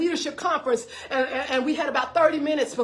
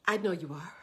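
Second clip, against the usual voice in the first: low, soft, unhurried reassurance; very calm; 5 semitones lower, 5 dB quieter than usual. Retold or imitated. Big sister answering a scared caller, very calm. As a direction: answer gently, voice dropped and settled. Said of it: "She was so calm".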